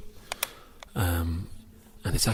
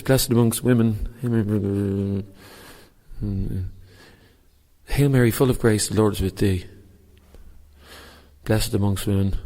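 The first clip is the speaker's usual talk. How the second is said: reciting a prayer aloud; 2 semitones higher, 4 dB louder than usual. Shown as a demonstration. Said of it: "this is for you, Count Dracula".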